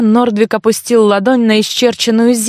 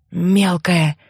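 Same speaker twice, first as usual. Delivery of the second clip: low, husky murmur; understated husky voice of a friend; hoarse greeting; 3 semitones lower, 4 dB quieter than usual. A narrator's reading. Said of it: "хрипло произнёс мой друг".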